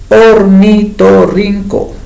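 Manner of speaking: ornitorrinco said slowly and clearly; slow clear model word. Shown as a demonstration. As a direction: lay out ornitorrinco for the class to hear. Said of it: "¿Cuántas sílabas formaría esta palabra?". (ornitorrinco pronunciado despacio y claro)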